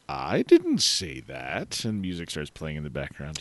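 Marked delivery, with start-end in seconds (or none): 0.1-1.8 mock English. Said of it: "because it was so English".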